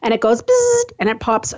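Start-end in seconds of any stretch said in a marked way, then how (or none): 0.4-0.9 high electric buzz; zapping bzzzt. Shown as a demonstration. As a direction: buzz it like a spark jumping. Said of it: "what was that like again".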